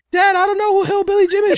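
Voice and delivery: put-on high whiny kid voice; mock child whining to his dad. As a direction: pitch way up like a bored little boy. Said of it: "kid voiced in a story".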